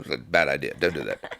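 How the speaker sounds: ordinary talk, nothing marked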